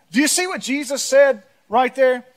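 ordinary delivery

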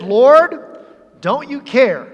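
acted irritation, pleading for attention; irritated. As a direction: demand it with a touch of annoyance. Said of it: "It was with a bit of an irritation".

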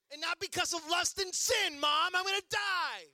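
high, hammed-up protest to mom; comic panicked cry, pitched high; mock despair. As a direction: wail like a doomed child. Retells panicked plea to mom.

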